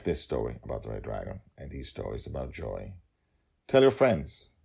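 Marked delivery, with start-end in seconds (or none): 3.7-4.5 higher, louder urging; eager plea. Acted. Host urging listeners to share, loud and eager. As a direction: push them to share it. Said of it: "repeated plea".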